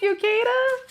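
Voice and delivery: high cooing thanks. Real, not acted. Mother cooing thanks to little Keita. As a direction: coo a thank-you to the little one.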